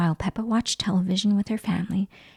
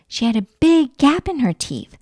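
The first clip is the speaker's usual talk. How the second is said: usual speaking voice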